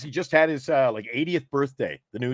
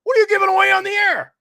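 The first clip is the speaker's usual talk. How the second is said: high, loud mock interrogation; indignant bosses; 7 dB louder, faster than usual. Bosses questioning his on-air giveaway, indignant. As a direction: imitate alarmed bosses, voice shooting up. Voices bosses challenging him.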